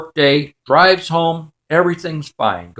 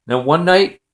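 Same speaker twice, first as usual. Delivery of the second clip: ordinary talk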